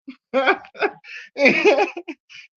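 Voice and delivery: big high burst of laughter; real loud laugh. Real, not acted. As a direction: crack up loudly at yourself. Laughing at own story.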